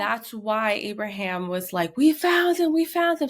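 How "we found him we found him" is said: high, loud imitated cry; excited shouting. Retelling the shout that the son is found, excited shouting. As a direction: shout the discovery in a raised voice. Retold cry of finding boy.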